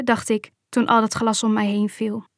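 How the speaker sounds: usual speaking voice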